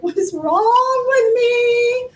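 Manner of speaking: high, slow anguished question; new mother losing it, asking high and slow; desperate inner cry. Retold or imitated. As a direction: cry out in despair at yourself. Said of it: "I'm like".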